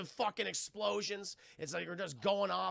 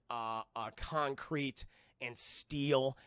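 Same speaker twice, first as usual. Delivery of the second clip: ordinary talk, nothing marked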